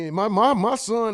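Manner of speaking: usual speaking voice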